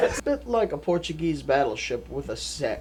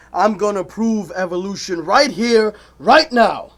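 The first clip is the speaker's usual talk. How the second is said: building declaration; 3 semitones higher, 7 dB louder than usual. Acted. Debater declaring he'll prove evolution.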